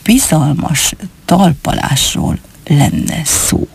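very slow, secretive disclosure; confidential. Acted. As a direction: spell out the secret word by word. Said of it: "halkabbra fogta a szavát". (bizalmasan, nagyon lassan, tagoltan)

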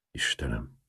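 plain reading voice

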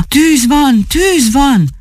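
high, urgent cry of fire; alarm cry. A narrator's reading. Someone raises the fire alarm for the street, urgent cry. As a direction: call the alarm out high, voice falling on each cry. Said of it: "Valaki elkiáltotta".

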